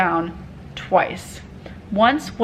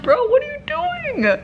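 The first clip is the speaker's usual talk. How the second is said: much higher, slow disbelief; hammed-up, high-pitched incredulous question; incredulous protest. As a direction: stretch it out high, acting out disbelief. Acts out disbelief at bomb squad.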